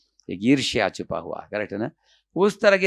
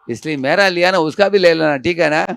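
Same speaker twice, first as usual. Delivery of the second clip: lightly acted wife, higher and louder; insistent wife; 6 semitones higher, 6 dB louder than usual. Wife urging a thief to take the neighbour's necklace too, mildly acted insistence. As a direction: press the demand in a raised voice.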